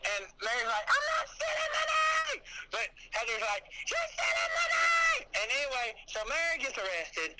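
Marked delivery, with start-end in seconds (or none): 0.9-2.4 very high, hammed-up protest; mock squealed denial. Co-host imitates Mary denying the theft, mock high-pitched squeal. 3.9-5.3 very high, hammed-up squeal; mock shrieked accusation. Co-host imitates Heather accusing Mary, mock high-pitched shriek.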